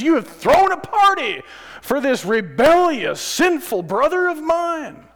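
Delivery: high, loud jealous complaint, slowing at the end; jealous angry brother. Acted. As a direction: rant like the bitter older brother. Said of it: "gets angry and frustrated and says".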